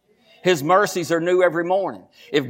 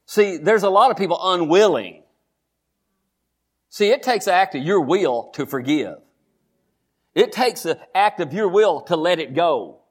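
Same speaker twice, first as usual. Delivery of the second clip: normal talking voice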